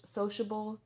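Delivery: ordinary delivery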